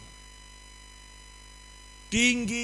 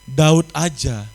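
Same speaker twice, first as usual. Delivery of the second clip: emphatic; 13 dB louder, slower than usual. Real, not acted. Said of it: "repeated for emphasis".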